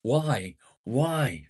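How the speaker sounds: high, loud indignant why; mock outraged why. Real, not acted. Streamer protesting a chat message, high indignant why.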